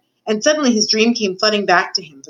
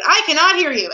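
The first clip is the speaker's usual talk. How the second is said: high-pitched, stubborn royal shout; insistent shout; 9 semitones higher than usual. A narrator's reading. King with hands over his ears, shouting stubbornly. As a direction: shout it down, refusing to listen. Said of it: "he stopped to shout".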